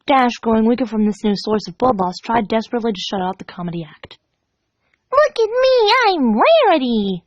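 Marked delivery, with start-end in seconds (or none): 5.1-7.2 much higher, slow posh mimicry; mock Rarity. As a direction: ham up a fancy lady. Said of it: "Pinkie laughed".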